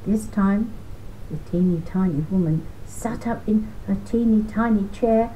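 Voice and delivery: plain reading voice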